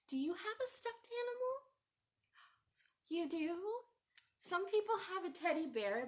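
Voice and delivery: a teacher leaning in to ask the class, excited. Real, not acted. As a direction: ask it like you can't wait to hear. Teacher asks children eagerly.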